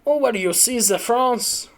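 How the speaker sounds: high-pitched, badly faked German accent; botched German accent. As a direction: attempt a German accent and miss. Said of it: "Uh, that's not German".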